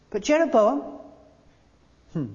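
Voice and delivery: ordinary talk, nothing marked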